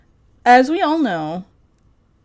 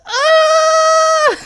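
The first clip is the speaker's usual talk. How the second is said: high, sustained fake crying scream; long hammed-up howl of grief; loud mock wail; 6 dB louder than usual. Streamer mourning a discontinued sandwich, mock howling. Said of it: "Where's my cry?".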